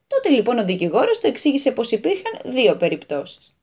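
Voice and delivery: ordinary delivery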